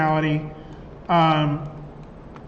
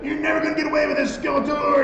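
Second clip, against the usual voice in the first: higher, fast hammed-up toy hero; kid's action figure voice; 7 semitones higher, faster than usual. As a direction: play the hero doll mocking a villain. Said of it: "they would talk like this".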